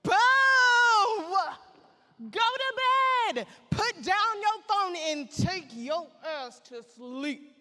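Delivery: loud, scolding order to bed; bossy friend yelling. Retold or imitated. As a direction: boss her off to sleep. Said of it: "my friend Kia was all like".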